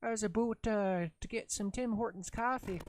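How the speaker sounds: put-on Canadian accent. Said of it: "So Canadian".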